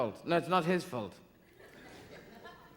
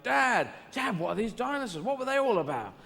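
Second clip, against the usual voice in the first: higher, eager son's voice; a son pestering his father in a raised pitch; childlike Jesus; 6 semitones higher than usual. Acted. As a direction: ask Dad like a curious kid.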